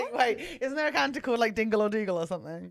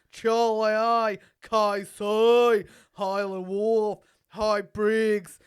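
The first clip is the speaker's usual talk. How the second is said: put-on Australian accent; 3 semitones higher, 3 dB louder than usual. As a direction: read the roll-call in broad Australian.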